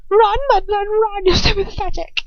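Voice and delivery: high, loud witch shrieking mockery; screaming taunt. A narrator's reading. Bellatrix shrieking after the stumbling captive, cruel and loud. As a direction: shriek it gleefully at the fleeing girl.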